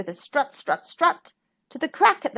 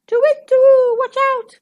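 high, loud owl hoot and warning; Owl crying out; 12 semitones higher, 8 dB louder than usual. A narrator's reading. Owl warns Hen about the fox, high hooting cry. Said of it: "cried Owl".

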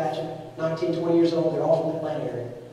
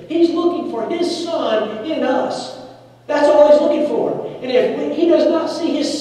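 rising, louder preaching build; 9 semitones higher, 6 dB louder, slower than usual. Real, not acted. Preacher pressing the congregation, full-force preaching. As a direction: drive it home from the pulpit.

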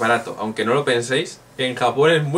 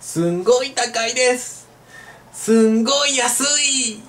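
put-on high, slow child voice saying it; much higher, childlike, drawn out; little kid voice; 10 semitones higher, slower than usual. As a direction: chirp it like a small child. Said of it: "sobre todo los niños".

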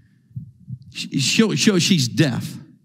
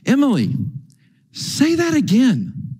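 acted disbelief; 8 semitones higher than usual. Preacher asking the interpreter to repeat it, in disbelief. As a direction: draw it out as if doubting your ears.

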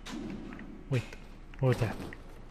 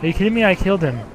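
higher, louder, incredulous; indignant protest; 5 semitones higher, 5 dB louder, much faster than usual. Real, not acted. Player who thought the enemy was killed, indignant protest. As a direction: protest it in disbelief.

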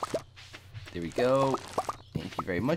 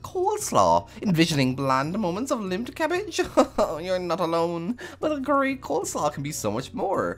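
high theatrical TV chef voice; grand cooking show host, much higher; Queen of Sauce; 9 semitones higher than usual. Pretend. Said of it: "Queen of Sauce".

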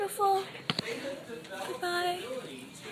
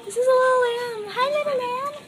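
high, sing-song cooing at a lamb; 5 semitones higher, 5 dB louder than usual. Real, not acted. Cooing over a baby lamb.

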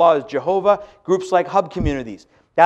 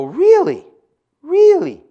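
much higher and louder disbelief; incredulous really; 11 semitones higher, 5 dB louder, much slower than usual. Pretend. Preacher mocking reaction to the jizya being abolished, incredulous. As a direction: gasp it in mock shock. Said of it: "You go".

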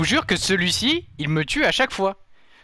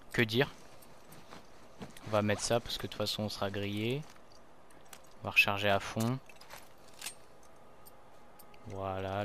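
quiet resigned; 4 semitones lower, 11 dB quieter than usual. Real, not acted.

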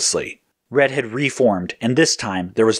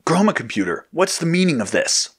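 higher-pitched, mildly indignant demand; 7 semitones higher than usual. A narrator's reading. Blue confronting the computer, demanding. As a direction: demand an explanation. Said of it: "Blue demanded".

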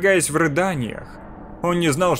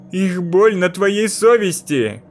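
slow, louder gloating accusation; 5 semitones higher, 4 dB louder, slower than usual. A narrator's reading. Mirror voice blames the boy over the screams, gloating. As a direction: savour his guilt out loud. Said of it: "злорадно произнёс голос из зеркала".

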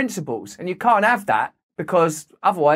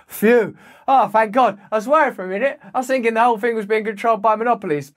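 higher-pitched, hammed-up sigh of relief; mock relief; 5 semitones higher than usual. Commentator sarcastically reacting to news of Microsoft's challenge, mock relief. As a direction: pretend a great worry has lifted.

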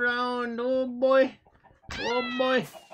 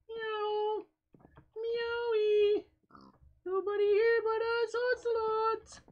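high squeaky kitty voice as the ocelot; high cat voice; 11 semitones higher than usual. Pretend. Gamer playing an ocelot, in a high kitty voice. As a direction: meow like a cute little cat.

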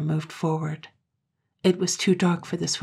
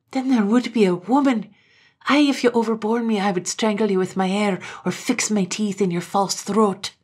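louder and higher, quick at first; fierce defiance; 4 semitones higher, 6 dB louder than usual. A narrator's reading. Katrine defying Gloom with fury. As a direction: spit the threat at him.

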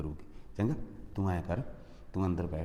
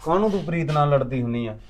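much louder, scolding his mother; 3 semitones higher, 9 dB louder than usual. Acted. Son loudly scolds mother for fighting with wife. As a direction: tell her off loudly.

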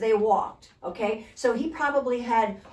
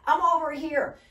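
mock call out; 7 semitones higher than usual. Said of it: "He could have just said".

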